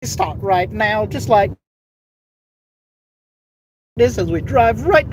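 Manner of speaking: high, put-on Filipino accent; hammed-up Filipino accent. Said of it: "So switching to Filipino".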